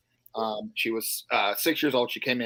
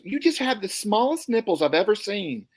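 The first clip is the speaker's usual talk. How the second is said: lady voice; 9 semitones higher than usual. Retold or imitated. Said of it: "And she goes".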